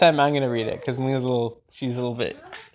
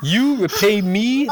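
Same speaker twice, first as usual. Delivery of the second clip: sputtering lion; 7 semitones higher, 6 dB louder, slower than usual. Acted. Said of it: "the lion sputtered".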